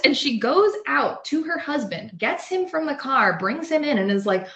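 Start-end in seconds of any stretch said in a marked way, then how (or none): none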